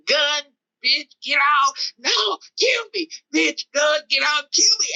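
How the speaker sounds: high, chopped back-and-forth of demand and refusal; frantic reenacted exchange. Gunman demanding, driver refusing to get out, frantic.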